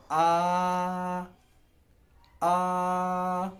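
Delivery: put-on a long flat high आ like a child opening its mouth for food; first tone. Shown as a demonstration. Teacher models first tone आ, held level and high. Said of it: "पहली tone ... स्टेट tone".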